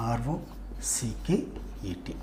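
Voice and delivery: slow, soft letter-by-letter spelling. Shown as a demonstration. Teacher spelling the word for learners, slowly spelled out. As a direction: spell it quietly, pausing between letter groups. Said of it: "rocket".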